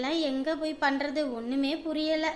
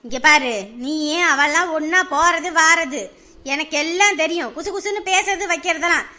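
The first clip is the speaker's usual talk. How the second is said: angry threat; 5 dB louder than usual. Acted. Mother threatening her daughter to tell where the friend went, angry. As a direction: threaten her, hard and loud.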